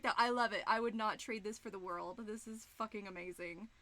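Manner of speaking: normal talking voice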